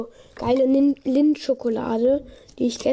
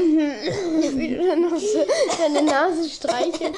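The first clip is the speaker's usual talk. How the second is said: laughing; slower than usual. Real, not acted. Laughing at friend.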